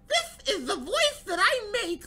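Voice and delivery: very high, loud nerd with a lisp; slow, squeaky lisp, played silly. Shown as a demonstration. Streamer mocking super nerds, high lisping nerd voice. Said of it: "with the lisp and all that".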